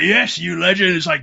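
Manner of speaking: loud, high mock acclaim; acted cheering inmate. Inmate hailing the new prisoner, loud acted cheer. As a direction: hail him like a rowdy mate.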